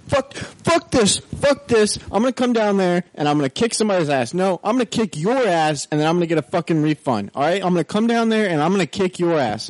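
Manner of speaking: high hammed-up ranting threat; mock furious customer. Customer on the phone threatening to kick ass, high mock fury. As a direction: squeak out the threats like an outraged caller.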